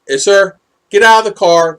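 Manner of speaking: trooper command. Retold or imitated. Trooper ordering him out.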